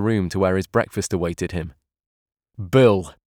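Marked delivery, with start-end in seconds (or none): 2.7-3.1 forced bold call, louder; bold and big. A narrator's reading. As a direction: put on a big brave voice to call him. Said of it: "in a voice that I thought he'd tried to make bold and big".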